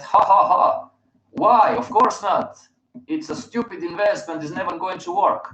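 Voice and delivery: high, scornful laughing brush-off; hammed-up scoff at Bitcoin; mock scoffing laugh. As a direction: laugh it off like a know-it-all. Mocking past self laughing.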